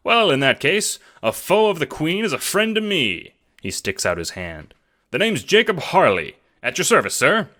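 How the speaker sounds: put-on high, loud hearty old gentleman; jovial. A narrator's reading. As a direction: greet him like a jolly old friend. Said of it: "Jacob suddenly looks jovial".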